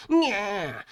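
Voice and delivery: high cowardly shriek; put-on scream of fear; frightened scream. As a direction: squeal like a scared coward. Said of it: "con miedo, el gordito gritó".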